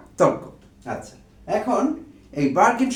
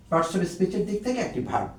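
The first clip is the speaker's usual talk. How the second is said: ordinary delivery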